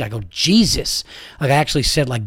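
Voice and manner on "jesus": higher, exasperated Jesus. Retold or imitated. Dad reacts to the rude mother, exasperated. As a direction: let it burst out in disbelief.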